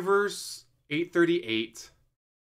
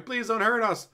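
put-on scared children pleading; 9 semitones higher than usual. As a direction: beg as a terrified child. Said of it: "They're crying".

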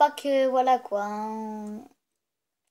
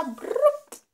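high buzzing motor sound; mock scooter revving; engine noise; 12 semitones higher, 3 dB louder than usual. Imitates Griezmann running in.

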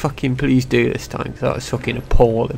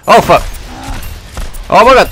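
sudden loud yelp; startled shout; 8 semitones higher, 5 dB louder than usual. Real, not acted. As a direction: shout out in shock. Surprised in game.

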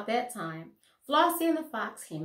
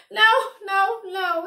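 sobbing; 9 semitones higher, 9 dB louder than usual. A narrator's reading. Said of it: "he sobbed".

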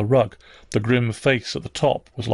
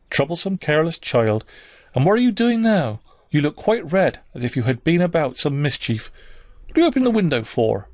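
higher-pitched, fussing scold; scolding nursemaid; 5 semitones higher than usual. A narrator's reading. Bessie questions a child suspected of mischief, scolding.

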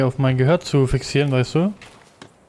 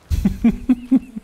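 squeaky high giggle; 10 semitones higher than usual. Real, not acted. High giggle after joke.

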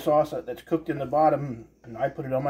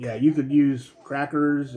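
usual speaking voice

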